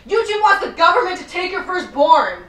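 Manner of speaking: high, loud mock conspiracy-video headline; mock alarmist title. Host mocking a conspiracy theorist's newest video, loud mock alarm. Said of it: "Jones's newest video entitled".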